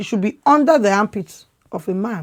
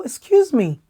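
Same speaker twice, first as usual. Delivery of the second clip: usual speaking voice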